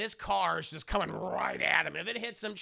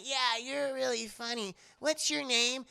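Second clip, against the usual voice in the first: put-on higher, cheerful little boy voice; little kid voice; 7 semitones higher than usual. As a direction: play the happy kid asking his name.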